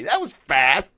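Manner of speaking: normal talking voice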